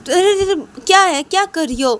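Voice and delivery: high, loud startled objection; alarmed protest. Acted. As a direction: cry out to stop an attack. Friend reacts to being hit. (घबराकर ज़ोर से रोकते हुए)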